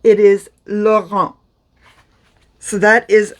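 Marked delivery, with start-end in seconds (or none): 0.7-1.3 "Laurent" in French, said lo-rahn with no T. Shown as a demonstration. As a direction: say "Laurent" with no final T. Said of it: "you do not pronounce the T".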